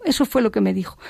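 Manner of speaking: normal talking voice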